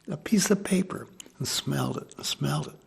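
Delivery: soft, breathy hush, as if in awe; hushed whisper. Retold or imitated. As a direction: drop to a near-whisper in wonder. Kids sniffing gum wrappers, awestruck.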